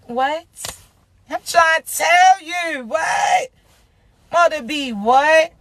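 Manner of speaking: playful hint of a celebrity switch. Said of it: "raspy voice gonna go from [name] to [name]".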